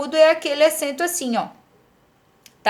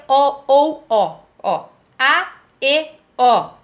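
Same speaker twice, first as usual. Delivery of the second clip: "O ou O, Ó, A, E, O" each held and separated; deliberate, drawn-out vowel sounds "O, Ó, A, E, O"; spaced-out vowels; slower than usual. Shown as a demonstration. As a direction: dictate "A, E, O" slowly, one vowel at a time. Teacher dictates the vowel endings.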